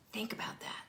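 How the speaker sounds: hushed aside; whisper. Real, not acted. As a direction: lean in and whisper it.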